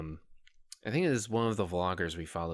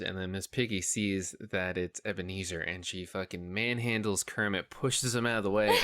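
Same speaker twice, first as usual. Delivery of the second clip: ordinary talk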